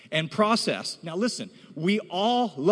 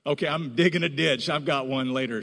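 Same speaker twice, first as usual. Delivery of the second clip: normal talking voice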